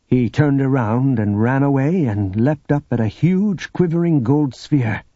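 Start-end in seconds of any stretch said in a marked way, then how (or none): none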